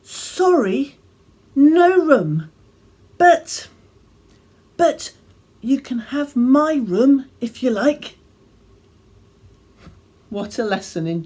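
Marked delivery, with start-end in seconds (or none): 0.0-8.2 put-on high, loud excited child's announcement; declaring innkeeper. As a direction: blurt it out high and loud, stumbling on but. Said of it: "he declared".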